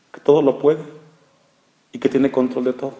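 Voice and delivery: ordinary delivery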